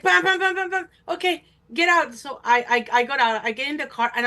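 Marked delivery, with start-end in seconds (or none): 0.0-2.1 high, loud mock honking; impatient honking boyfriend. Boyfriend summoning her with the horn, impatient. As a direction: hammer out the bams impatiently. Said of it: "He's like".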